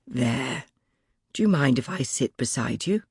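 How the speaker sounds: a hint of a quiet young man, kind; young man, gentle. A narrator's reading. Young man's lines in the story.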